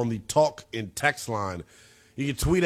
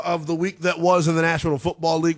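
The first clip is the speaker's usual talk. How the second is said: ordinary talk, nothing marked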